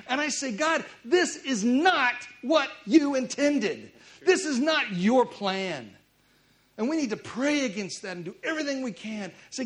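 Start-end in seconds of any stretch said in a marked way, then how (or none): 0.5-5.9 louder, slow prayer, each word pressed; slow, louder preacher's plea to God; fervent slow plea. Real, not acted. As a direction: cry out to God, drawing every word out. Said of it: "something stirs in me".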